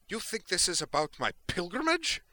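loud, high, incredulous fury; outraged demand. A narrator's reading. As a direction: voice sliding right up on "pilgrimage". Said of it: "her voice sliding up almost a full octave in the last word".

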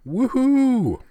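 high, loud joyful woohoo; loud whoop. Acted. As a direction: whoop it out as she leaps. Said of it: "With a loud".

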